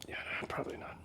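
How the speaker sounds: breathy whisper under the breath; whispered aside. Real, not acted. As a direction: whisper it like a private doubt. Sceptical aside to camera.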